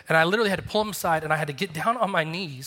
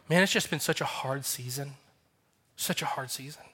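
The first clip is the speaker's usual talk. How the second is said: mock weary lament; 4 semitones lower, 7 dB quieter than usual. Imitating weary friend.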